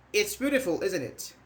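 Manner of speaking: "It's beautiful, isn't it?" falling at the end; falling intonation. Shown as a demonstration. Teacher models a tag for comparison, falling intonation. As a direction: bring "isn't it" down like a plane landing. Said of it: "This is falling".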